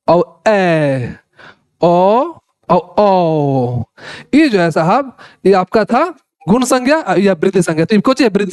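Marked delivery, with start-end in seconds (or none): 0.5-1.1 very long, low "ए"; drawn-out vowel. Shown as a demonstration. Teacher contrasting the lengthened vowel for students, drawn out. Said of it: "फिर से समझिएगा". 1.8-3.8 low "ओ", then a much longer "ओ"; drawn-out vowel. Shown as a demonstration. Teacher contrasting two grades of vowel, drawn out. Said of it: "फिर से समझिएगा".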